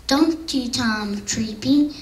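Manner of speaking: usual speaking voice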